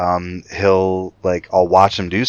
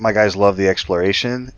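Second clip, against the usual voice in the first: ordinary talk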